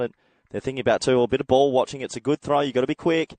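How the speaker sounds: excited. Real, not acted. Live call of a quick two runs.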